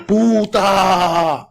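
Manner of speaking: loud high mock yell of Puta. Mother yelling at the neighbor across the fence, loud and high. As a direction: shout Puta at the neighbor's window. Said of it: "She's out there yelling and screaming".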